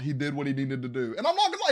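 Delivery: ordinary talk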